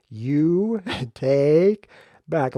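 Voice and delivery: You take back, each word drawn out slow; take back said slowly. Shown as a demonstration. Spelling out take back slowly for the viewers, drawn out. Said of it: "Let me say it slower".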